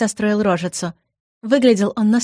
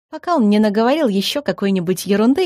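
ordinary delivery

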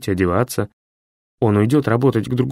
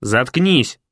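nasty voice. A narrator's reading. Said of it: "противный голос".

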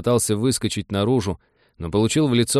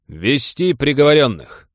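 slow, loud, higher command; shouting drill sergeant; 6 semitones higher, 5 dB louder, slower than usual. A narrator's reading. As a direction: call it out across the deck slowly. Commander orders prisoners brought out.

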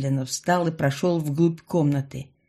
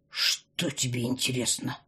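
low, soft menacing near-hiss; half-hiss it at her; 6 semitones lower, 7 dB quieter than usual. A narrator's reading. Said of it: "почти прошипел котяра".

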